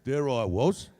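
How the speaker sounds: low London storyteller accent; Cockney-style accent. Shown as a demonstration. Showing how to add an accent, Cockney-style. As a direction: spin a yarn in Cockney. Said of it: "add an accent".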